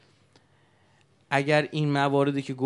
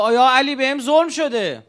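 anguished cry; 10 semitones higher, 11 dB louder than usual. Retold or imitated. Man cries out to Ali.

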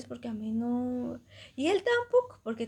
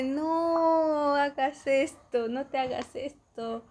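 slow, drawn-out plea; 3 semitones higher, 6 dB louder, much slower than usual. Retold or imitated. Retold pleading rejection.

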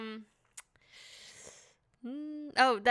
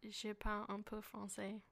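French; 11 dB quieter than usual. Retold or imitated. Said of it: "it sounds fake".

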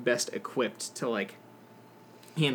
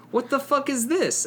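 much higher, incredulous what the fuck; shocked disbelief; 11 semitones higher than usual. Retold or imitated. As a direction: recoil at an unfair attack, pitch jumping up. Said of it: "and I'm like".

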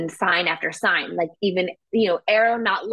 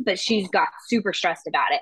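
ordinary talk, nothing marked

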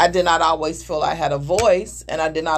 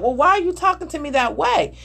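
higher, hurt question; wife mimicking a defensive reply to her husband, higher; raised defensive voice; 7 semitones higher than usual.

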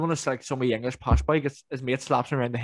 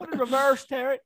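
mock panic; 12 semitones higher than usual. Continues the panicked quote.

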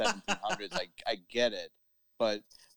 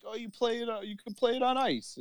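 high, hammed-up explaining voice; high mock explainer voice; mock colonial voice; 12 semitones higher than usual. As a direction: explain hockey like an old-timer. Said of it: "And it's like".

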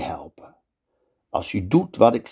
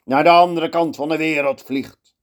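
higher, louder victorious cry; triumphant shout; 7 semitones higher, 3 dB louder than usual. Acted. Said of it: "Hij schreeuwt".

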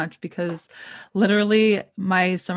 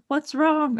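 concerned high ask; 8 semitones higher than usual. Retold or imitated. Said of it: "be like".

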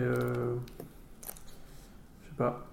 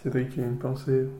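ordinary talk

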